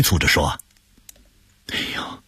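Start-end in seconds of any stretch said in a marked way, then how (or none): 1.7-2.2 hushed urgent aside; whispered aside. Acted. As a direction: whisper it so the boy won't hear. Urgent aside to his brother.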